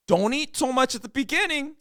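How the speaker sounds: loud, high-pitched warning; mock scolding yell. Friend warning against early overeating, exaggerated high yell. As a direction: yell it like a nagging friend, hammed up.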